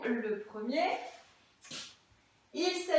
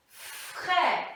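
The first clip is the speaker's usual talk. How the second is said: "Frère" said alone and drawn out; word set apart. Shown as a demonstration. Teacher sounding out a word for pupils, "Frère" isolated and stretched. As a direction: stretch "Frère" as its own segment. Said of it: "je segmente les mots".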